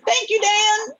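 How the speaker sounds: bright high natural thanks; lively spoken thanks. Shown as a demonstration. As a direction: say it like real speech. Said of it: "that's not the way you talk".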